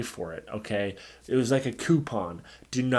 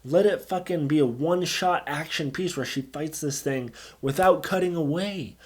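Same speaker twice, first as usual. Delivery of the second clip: ordinary delivery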